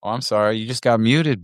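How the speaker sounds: normal talking voice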